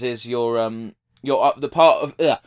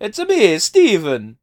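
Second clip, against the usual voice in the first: mock Mario voice; 7 semitones higher, 6 dB louder, slower than usual. Mock Mario-style self reveal.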